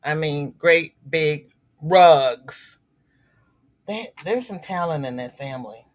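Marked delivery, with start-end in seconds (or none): 0.5-2.8 very slow, louder, each word stretched; drawn-out admiring emphasis. Real, not acted. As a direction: stretch it out in awe. Admiring son-in-law's rugs.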